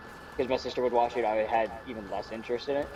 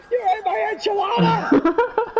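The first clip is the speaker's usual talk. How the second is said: very high, loud, drawn-out freakout; hammed-up shrieking attack cry; Crazy Steve; 8 dB louder, slower than usual. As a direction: scream it like a sitcom maniac. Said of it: "three freakouts".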